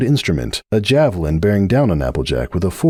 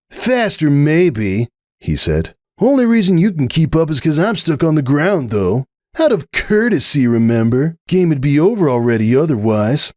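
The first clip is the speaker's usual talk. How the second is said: raised, huffy stallion voice; defensive boast; 7 semitones higher than usual. A narrator's reading. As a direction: insist he's just holding back. Hoops defending his pride.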